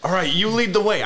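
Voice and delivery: high-voiced comic deer giving an order; talking deer, first order. Deer bossing its mate around.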